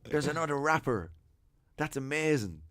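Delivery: mildly wowed, acting out the memory; retold amazement. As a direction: relive a thrilling discovery. Retelling his amazed first reaction.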